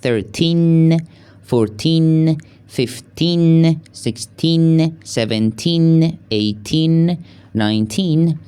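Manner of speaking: put-on teens with the final n said clearly; teen drill. Shown as a demonstration. Teacher pointing out the n at the end of teens. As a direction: mark the n ending on each teen. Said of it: "آخرش ان داره".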